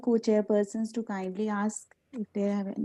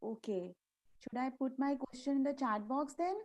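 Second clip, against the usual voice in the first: faint, far-off voice on a weak line; barely audible. Real, not acted. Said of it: "but your voice is very low".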